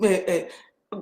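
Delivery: usual speaking voice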